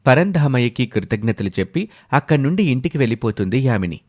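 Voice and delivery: usual speaking voice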